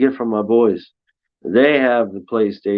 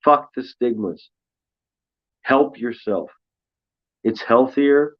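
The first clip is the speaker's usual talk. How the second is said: ordinary delivery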